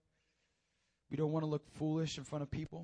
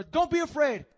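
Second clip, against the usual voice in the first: high, loud urging calm; Jesus calling to frightened disciples; called out reassurance; 10 semitones higher, 13 dB louder than usual. Acted. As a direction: call it out so they hear. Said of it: "he has to say it again".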